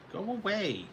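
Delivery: high pet-talk voice. Real, not acted. Shooing the pet Tassie away, high sing-song voice.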